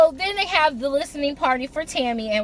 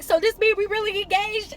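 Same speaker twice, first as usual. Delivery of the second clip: amazed question; 9 semitones higher than usual. Retold or imitated. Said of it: "she was like".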